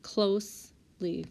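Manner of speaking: "closely" slowly split into close, then ly; syllable by syllable. Shown as a demonstration. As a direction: say "closely" slowly with a break before the ly. Rechecks word syllable by syllable.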